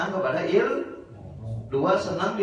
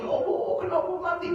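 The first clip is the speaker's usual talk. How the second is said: mock lament; 13 semitones higher than usual. Mourner lamenting a death.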